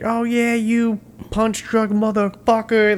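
high-pitched mocking trash talk; talking shit. Group following Rocky out talking shit, imitated high and jeering. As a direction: imitate a heckler's high, drawn-out taunt. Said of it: "they're talking shit".

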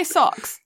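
socks with a rounded British O. Shown as a demonstration. Friend coaching co-host, socks with rounded O. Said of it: "with an O".

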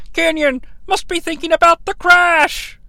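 high-pitched imitated commentator; Tony Schiavone. Recapper mimicking the announcer on the crash, high commentator voice. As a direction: call it out high, with announcer earnestness. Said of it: "Tony is all like".

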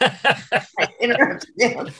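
said through a real laugh, slow. Real, not acted. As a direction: chuckle it out. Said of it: "I started laughing again".

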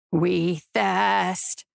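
low, thirsting creature; predator voice. A narrator's reading. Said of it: "the predator said".

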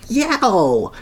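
a high startled yelp; shriek. Acted. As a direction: yelp like you just grabbed a hedgehog. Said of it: "the bowler would shriek".

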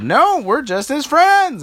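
high, loud, slow exasperated reply. Acted. Princesses losing patience with the old lady, loud and fed up. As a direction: spell it out in frustration. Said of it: "they started to get angry".

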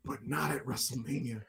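low, very soft reveal; hushed letdown. Retold or imitated. Fan retelling Cody's shock announcement, hushed. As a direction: drop the voice right down for the bombshell.

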